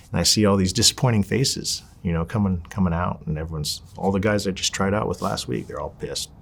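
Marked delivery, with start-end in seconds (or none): none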